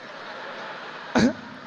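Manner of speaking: breathy, voiceless laugh. Real, not acted. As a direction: laugh helplessly at your own story. Laughs at his own story.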